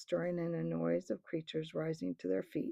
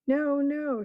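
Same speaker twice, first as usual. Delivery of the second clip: raised, loud protest; crowd shouting; 6 semitones higher, 8 dB louder than usual. A narrator's reading. The crowd shouting back at the Tarkan, loud. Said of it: "shouted the most of the crowd".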